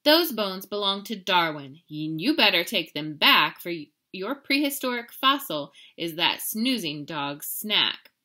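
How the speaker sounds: loud, stern scolding; scolding mother. Acted. Mama scolding Skippy about the stolen bones. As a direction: lay down the law to your kitten.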